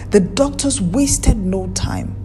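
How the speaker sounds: ordinary delivery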